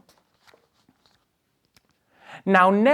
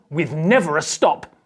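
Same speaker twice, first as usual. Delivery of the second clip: plain reading voice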